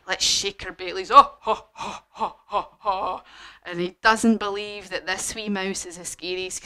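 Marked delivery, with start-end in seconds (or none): 1.1-3.2 acted belly laugh, ho-ho-ho; mock big laugh, loud burst then easing off; Gruffalo laughing. Gruffalo laughing at the mouse, hearty acted laugh. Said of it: "the Gruffalo started laughing".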